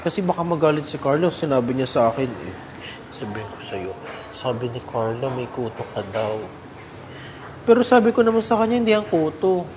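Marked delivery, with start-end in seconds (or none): 3.1-6.4 low, soft secret-telling, as if behind a hand; a child whispering gossip to a friend; whispered secret. Acted. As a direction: lean in and tell her the secret quietly.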